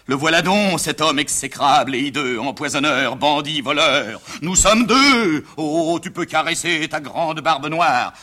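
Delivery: drawn-out theatrical tirade; stage tragedian in full rant; declaiming tragic hero. Acted. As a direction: declaim grandly, stretching each insult. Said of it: "papa, je répète".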